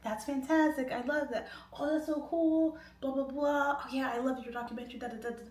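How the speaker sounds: high, breezy fan chatter; starstruck gushing. Retold or imitated. Fan invited onto [name]'s plane, high starstruck gushing. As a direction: gush on in a high pitch.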